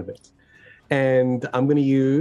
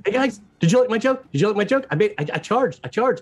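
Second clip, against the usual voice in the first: excitable babble, pitched up; eager pestering; 5 semitones higher, faster than usual. Acted. Carrot asks friends about the joke, eager pestering. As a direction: rattle off eager little repeats, fishing for approval.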